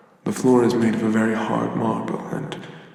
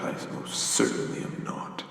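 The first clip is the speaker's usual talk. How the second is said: menacing whisper; 11 semitones lower, 7 dB quieter than usual. Acted. Giant warns about thieves.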